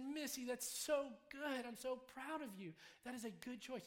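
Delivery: high, drawn-out, emphatic praise; re-enacting heartfelt delight; proud congratulations.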